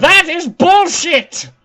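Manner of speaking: high, loud, slow protest; outraged shout. Real, not acted. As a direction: bellow it in outrage. Loses the round.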